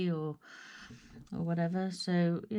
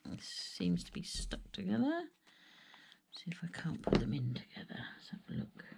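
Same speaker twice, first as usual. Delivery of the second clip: whispering to herself; 5 dB quieter than usual. Real, not acted. Murmuring to herself while working.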